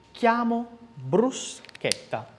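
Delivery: chiamo and bruschetta slowly, hard K; slow model with hard K; slow K sounds. Shown as a demonstration. Teacher modelling CH words slowly. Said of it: "eu tenho chi e che".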